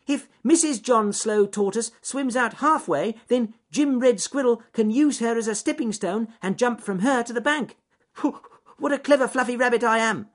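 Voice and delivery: high, loud excited squeak, proud at the end; Ernest Fluffy Rabbit. Acted. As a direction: squeal the plan out eagerly. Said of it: "shrieked Ernest Fluffy Rabbit".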